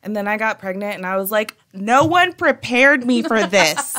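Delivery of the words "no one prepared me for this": loud, higher, hammed-up outrage with laughter; comic overblown indignation; dramatic complaining. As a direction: wail about it in mock despair, drawn out. Acting out own pregnancy complaints.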